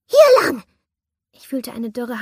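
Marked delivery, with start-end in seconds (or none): 0.1-0.6 high, loud urgent call; high shrill call. Acted. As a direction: beckon her shrilly. Shrill stranger calls her.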